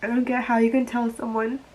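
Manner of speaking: crying over the breakup; real crying. Real, not acted. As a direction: push the words out through tears. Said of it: "why am I crying about this".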